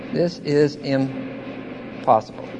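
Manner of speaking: slow, low and soft; hushed repetition. Real, not acted. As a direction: let it sink in, quietly and slowly. Same words repeated softly for effect.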